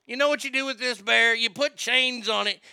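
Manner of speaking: ordinary talk, nothing marked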